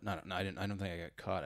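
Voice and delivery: ordinary talk, nothing marked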